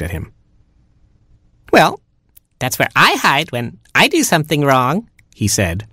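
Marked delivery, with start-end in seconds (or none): 1.7-5.1 very high squeaky little chick; a tiny baby chick whining. Acted. Baby chick complaining to parents, very high squeak.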